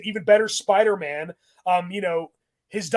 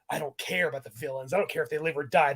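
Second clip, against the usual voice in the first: mock badass Peter; 5 semitones lower, 6 dB quieter than usual. Peter's badass attitude impression.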